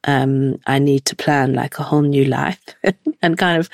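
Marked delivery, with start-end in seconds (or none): none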